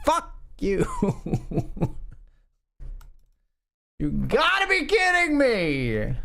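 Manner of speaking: high-pitched cry of disbelief; incredulous, high, drawn-out protest; exasperated outburst. Real, not acted. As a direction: squeal in outrage at a bad beat. Loses hand to the card.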